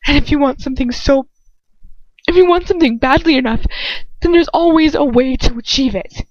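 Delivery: Lucius pleading. Acted. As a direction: press the point hard.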